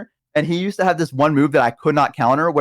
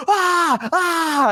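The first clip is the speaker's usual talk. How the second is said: high, airy mock yelps; open-mouthed high "ah" like a startled dog; imitated dog cry; 13 semitones higher than usual. Friend imitating the slammed dog, high cries.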